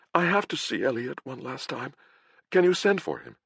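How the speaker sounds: high, urgent pleading voice; desperate plea. Acted. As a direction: beg in a frightened girl's voice. Dying girl begging to see husband.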